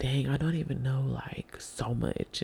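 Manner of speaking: unvoiced hushed whisper. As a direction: whisper it to yourself. Imagined private doubt on trip.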